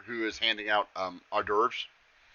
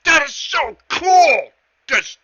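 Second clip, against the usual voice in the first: very high, loud, slow delighted cry; ecstatic satyr; 12 dB louder, slower than usual. Acted. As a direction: gush at the magic like an overjoyed child. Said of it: "He's like".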